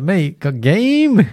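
high, very slow cocky drawl; smug boast. Retold or imitated. Bragging to his friend about charming her, slow and cocky. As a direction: stretch it out like a self-satisfied player. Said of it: "I was like".

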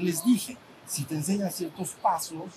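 ordinary delivery

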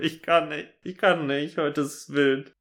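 high breaking laugh through words; can't stop laughing. Real, not acted.

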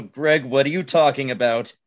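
normal talking voice

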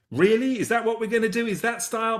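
high-pitched, judging disbelief; mock incredulous. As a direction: question it like the fashion police. Mock fashion disapproval of Ugg boots.

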